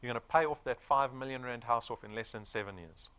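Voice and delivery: normal talking voice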